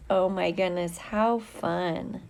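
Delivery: normal talking voice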